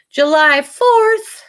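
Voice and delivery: slow, high, loud emphatic date; excited. Real, not acted. Owner announcing her dog's birthday, slow excited emphasis. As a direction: savour the date proudly.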